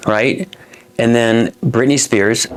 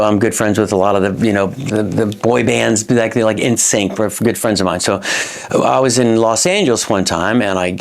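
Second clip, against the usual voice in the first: usual speaking voice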